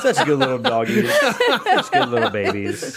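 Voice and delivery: high, slow cooing to puppies; baby talk. Acted. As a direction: coo over them like a pet owner. Cooing over dogs on screen.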